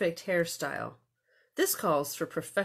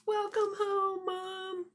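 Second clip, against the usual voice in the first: put-on high, slow little-girl voice; sweet child greeting; 14 semitones higher, slower than usual. Greeting mother at the door.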